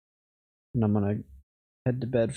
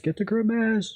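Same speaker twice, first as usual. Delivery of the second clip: urgent shout; 12 semitones higher than usual. Real, not acted. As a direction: yell it again, much higher. Repeats the call, escalating.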